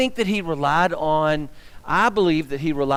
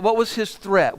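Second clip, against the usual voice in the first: ordinary talk, nothing marked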